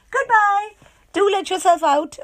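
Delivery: high, loud mock farewell; cheery Miss Contrary. A narrator's reading. Mother voicing Miss Contrary welcoming her guest, chirpy. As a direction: sing it out brightly.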